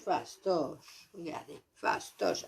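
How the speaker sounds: Regardez, fastoche breathed without voice; whispered quote. Retold or imitated. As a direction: whisper it like a sly aside. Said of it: "elle dit". (citation chuchotée, à voix basse)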